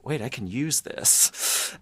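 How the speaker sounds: slow, drawn-out dawning idea; slow realization. Retold or imitated. The writer realizing a draft idea, slow. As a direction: let the idea dawn on you slowly. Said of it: "I was like".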